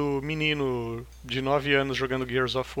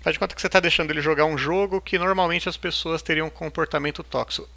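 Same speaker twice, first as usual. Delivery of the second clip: ordinary delivery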